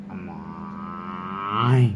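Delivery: very slow, long drawn-out moo; buffalo moo. Shown as a demonstration. Mother showing child how a buffalo calls, slowly. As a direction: stretch it out like a buffalo. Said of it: "म्हैस कशी ओरडते?".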